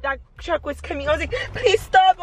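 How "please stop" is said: higher-pitched plea, re-enacted; retold begging. Owner retelling the oncoming truck, higher begging voice. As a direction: replay begging a truck to stop. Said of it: "I was like begging".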